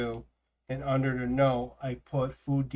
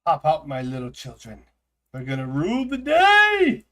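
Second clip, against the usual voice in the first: swarm queen voice; 7 dB louder than usual. Pretend. Calling eggs to hatch.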